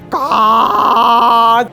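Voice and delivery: slow-motion cry. Pretend. Slow-motion fight climax.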